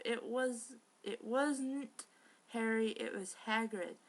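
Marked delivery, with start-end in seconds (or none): none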